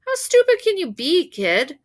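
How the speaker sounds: high, loud mocking scold; scornful usher. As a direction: sneer at the kid.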